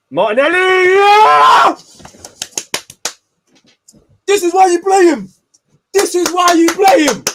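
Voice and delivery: very high, very loud screamed cheer; real goal celebration shout. Real, not acted. Fan celebrating Martinelli's goal, screaming with excitement. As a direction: scream it out in jubilation.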